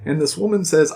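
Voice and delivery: ordinary delivery, nothing marked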